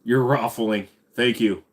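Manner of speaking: normal talking voice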